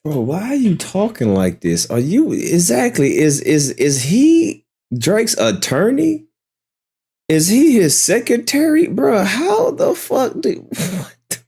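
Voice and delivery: can't believe what he's hearing, voice climbing; incredulous disbelief. Real, not acted. Incredulous at streamer's [name] talk.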